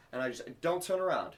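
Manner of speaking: usual speaking voice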